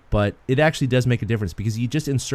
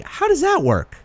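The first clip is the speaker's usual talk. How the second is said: much higher, puzzled question; baffled; 3 dB louder than usual. Retold or imitated. Host voicing his confusion about the goalie rule, high and baffled. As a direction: ask it like it makes no sense. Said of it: "And I'm like".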